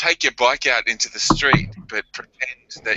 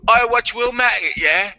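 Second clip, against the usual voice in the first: high, put-on English accent; hammed-up English accent with a questioning tag; 11 semitones higher than usual. Shown as a demonstration. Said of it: "do it in an English accent".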